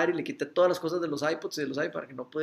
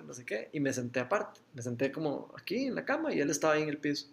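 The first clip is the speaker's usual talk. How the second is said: normal talking voice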